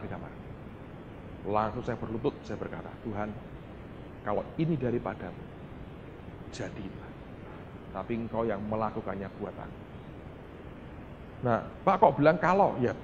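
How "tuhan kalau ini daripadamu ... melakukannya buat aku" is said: soft, low murmured prayer; hushed, low plea on your knees; humble prayer. Retold or imitated. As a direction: submit to God quietly, with long pauses. Kneeling night prayer, retold.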